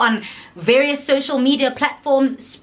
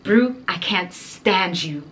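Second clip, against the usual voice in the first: deadpan friend; 4 semitones lower, slower than usual. Retold or imitated. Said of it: "She's like".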